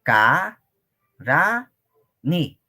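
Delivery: "Ka, ra, ni" with a pause after each syllable. Shown as a demonstration. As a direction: spell out "Ka, ra, ni" one beat at a time. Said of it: "cuántas sílabas tiene".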